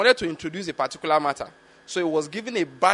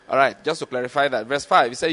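normal talking voice

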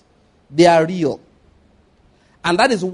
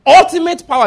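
ultimate power called out high and loud; loud emphatic climax; 8 semitones higher, 8 dB louder than usual. Acted. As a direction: proclaim it like a rising cry.

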